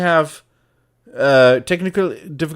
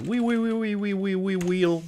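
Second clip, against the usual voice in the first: playful singsong; 6 semitones higher, 5 dB quieter than usual. Pretend. Streamer naming a new window, playful high singsong. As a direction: let the silly word run on, high-pitched.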